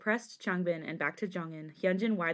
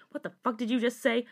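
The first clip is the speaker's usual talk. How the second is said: higher-pitched, outraged question; angry demand; 5 semitones higher, 3 dB louder than usual. A narrator's reading. Cousin reacts to Jisung's confession, outraged. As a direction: demand it in disbelief. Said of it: "growling at him".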